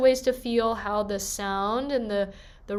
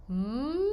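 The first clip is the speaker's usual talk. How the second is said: gentle hum gliding up; rising hum; 3 semitones higher than usual. Shown as a demonstration. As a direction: slide the hum upward gently. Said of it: "doing things like this".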